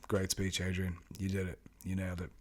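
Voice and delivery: imagined self-talk, low-key and soft; quiet self-congratulation. Retold or imitated. Actor praising himself after leaving the stage, quiet self-congratulation. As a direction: say it softly, as if to yourself.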